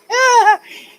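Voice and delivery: real high-pitched laughing fit. Real, not acted. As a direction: burst out laughing in a high squeal. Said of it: "I'm laughing because".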